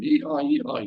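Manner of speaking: high whooping cheer like a winning team; mock victory whoop. Preacher imitating players cheering their win, high whoop. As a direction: yelp it high like players celebrating.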